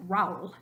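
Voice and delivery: RAÚL squashed to "rowl"; one-syllable Raúl. Shown as a demonstration. Teacher showing it without the accent, Raúl as "rowl". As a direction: glide A into U in Raúl. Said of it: "you'd pronounce it Raúl".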